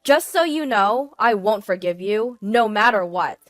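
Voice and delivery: loud, forceful threats; furious. A narrator's reading. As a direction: cut him off and vow revenge. Wife's final furious threat.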